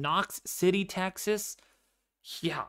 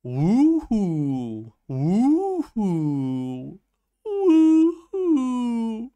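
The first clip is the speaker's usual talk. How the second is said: mock girl's voice; 7 semitones higher, 7 dB louder, much slower than usual. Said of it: "I don't have a girl's voice".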